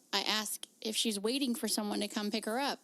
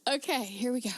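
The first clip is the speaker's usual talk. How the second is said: whisper; faster than usual. Real, not acted. Whispered start before reading.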